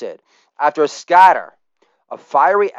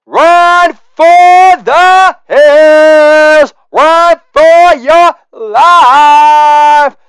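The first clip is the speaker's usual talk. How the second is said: high, very loud, slow wailed siren scream; screaming metal singer; 11 semitones higher, 8 dB louder, much slower than usual. Acted. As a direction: wail it like an air raid siren. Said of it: "a voice emerged from the fire that screamed".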